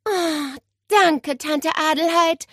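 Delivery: high sigh of relief, then thanks; relieved groan. A narrator's reading. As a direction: sigh out your gratitude. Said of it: "stöhnte Nele erleichtert".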